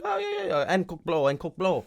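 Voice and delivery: high, soft eager patter; dealer impression.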